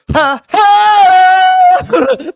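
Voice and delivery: loud, high mock cheer; mock shout. Retelling Amy's big moment, a loud mock cheer. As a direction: belt out a hammed-up cackle. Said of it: "she goes".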